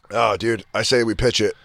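ordinary delivery

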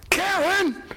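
high frantic shouting at Karen; panicked yelling at his wife over lost money; Henry Hill screaming at Karen; Ray Liotta as Henry Hill. Retold or imitated. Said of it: "I wanna nail the words right".

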